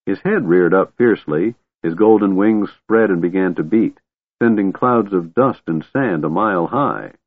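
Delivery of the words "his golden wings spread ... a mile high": ordinary delivery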